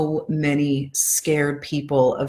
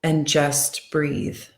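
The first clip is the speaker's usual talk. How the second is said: guided affirmations. Acted. Tapping coach guiding her followers through calming affirmations. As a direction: ease them into calm, one line at a time.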